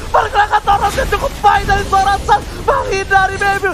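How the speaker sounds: shouting, high and loud; hype shout. Real, not acted. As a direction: yell it, thrilled by the play. Double kill hype.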